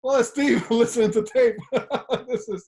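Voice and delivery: high laughing reveal; amused, laughing as he quotes it. Retold or imitated. Retelling discovering coach's tapes.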